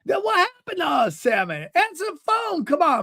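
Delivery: put-on high-pitched, pleading old man; wounded older man. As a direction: implore him in a high voice. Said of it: "It's how he talks".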